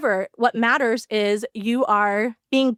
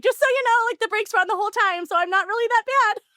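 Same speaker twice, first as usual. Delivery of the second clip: much higher, quick defensive squeak; vindicated; 11 semitones higher, faster than usual. Retold or imitated. Retelling the call to the boyfriend about the stuck brake, high and vindicated. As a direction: prove you weren't that bad after all. Calling boyfriend to justify herself.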